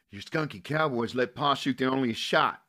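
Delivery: high, loud bitter accusation; accusing. Acted. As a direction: accuse him in a fury. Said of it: "she accused Matt".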